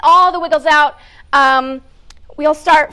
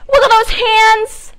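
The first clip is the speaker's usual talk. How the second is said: higher, louder sing-song coaching; upbeat exercise leader; 8 semitones higher, 5 dB louder than usual. Acted. Host to children at storytime, bright sing-song coaching. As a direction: cheer the kids through each wiggle. Leads kids' wiggle warm-up.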